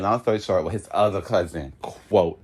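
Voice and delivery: ordinary talk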